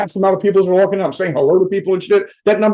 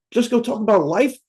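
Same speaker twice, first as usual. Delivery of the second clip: usual speaking voice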